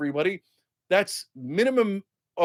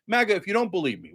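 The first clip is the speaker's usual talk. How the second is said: ordinary talk, nothing marked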